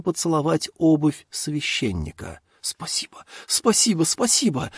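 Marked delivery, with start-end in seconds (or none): 2.6-3.2 breathless whispered thank you. A narrator's reading. Farmer overwhelmed with gratitude. 3.5-4.7 higher, bursting gratitude; overjoyed thanks. A narrator's reading. Farmer overwhelmed with gratitude.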